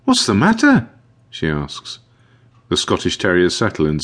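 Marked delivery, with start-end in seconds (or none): 0.0-0.9 high-pitched, concerned question; high voice of an anxious young helper; worried young caretaker. A narrator's reading. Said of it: "Jenny's cheery smile disappears when she sees".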